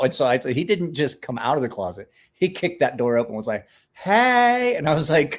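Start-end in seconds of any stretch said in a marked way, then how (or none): 3.9-4.8 mock loud greeting. Friend kicking closet door open.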